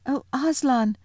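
put-on high, pleading girl's voice, not fully whispered; anxious child's appeal; whispering girl. A narrator's reading. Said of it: "whispered Susan in the lion's ear".

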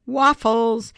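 grandpa calling the family down the hall; a bright call of waffles through the door. Acted. Grandpa calls out waffles past the bedroom door, loud. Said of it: "Grandpa calling out".

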